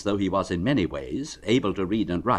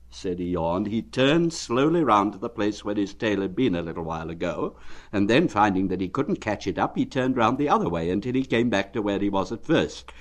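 plain reading voice

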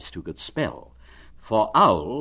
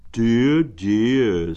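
sympathetic bear; 2 semitones higher, 6 dB louder than usual. Acted. Pooh sympathizing with sad Eeyore. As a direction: cluck over him kindly.

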